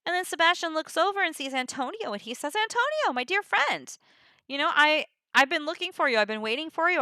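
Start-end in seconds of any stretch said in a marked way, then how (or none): 2.5-7.0 high, play-acted delight at seeing a friend; excited greeting. Sebastian spots Antonio, his dear friend, high excited greeting. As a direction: call out high, then ease down.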